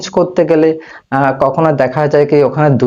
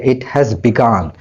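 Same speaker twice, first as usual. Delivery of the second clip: slow example; 3 semitones lower, much slower than usual. Shown as a demonstration. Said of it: "slow example".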